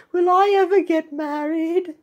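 squeaky, high-pitched asker; high questioning character voice. Acted. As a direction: ask in a high little cartoon voice.

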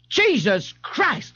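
slow, high exclamation of exasperation; exasperated sergeant. A narrator's reading. A sergeant reacts to his patrolman's excuse, exasperated. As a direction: stretch the oath out in disbelief.

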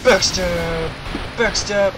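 loud excited callout; excited shout. Real, not acted. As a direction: shout it in triumph. Excited backstab call.

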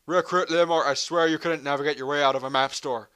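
loud barking drill sergeant. Acted. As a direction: dress down the recruit. Drill sergeant berating recruit.

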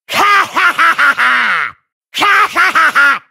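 staccato, shouted "ha-ha" cackle; loud battle laugh. Acted. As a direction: laugh madly in bursts. (τρελό, δυνατό γέλιο)

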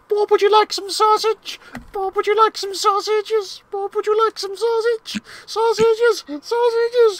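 high imitation of the sausage song; sausage song impression. Player imitates the sausage song. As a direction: squeak it high and looping. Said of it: "me doing the fucking sausage song".

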